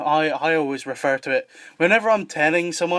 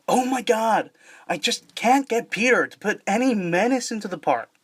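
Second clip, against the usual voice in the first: higher-pitched American cartoon character; American accent, pitched up for animation; 7 semitones higher than usual. Shown as a demonstration. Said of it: "did an American accent".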